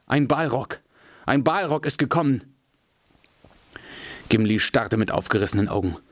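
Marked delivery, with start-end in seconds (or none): 0.1-2.5 higher, alarmed elf crying out the danger; horrified cry. A narrator's reading. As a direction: cry out in horror at the sight. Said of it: "jammerte Legolas".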